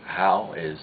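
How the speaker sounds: normal talking voice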